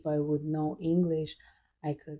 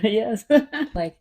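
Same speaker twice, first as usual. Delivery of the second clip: breaks into a real laugh, higher and louder; 6 semitones higher, 9 dB louder than usual. Real, not acted. Guest laughing to the host about her family. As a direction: laugh through the words.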